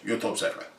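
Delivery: hushed whisper. Acted. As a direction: whisper the sign-off softly. Whispered sign-off.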